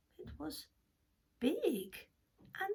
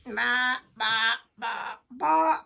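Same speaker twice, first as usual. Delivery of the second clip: sheep bleat; 9 dB louder, slower than usual. Acted.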